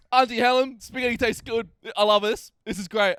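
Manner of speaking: put-on delighted kid. Riley tells Auntie Helen the spaghetti is great, delighted. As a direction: gush like a thrilled toddler. Kid suddenly happy eating.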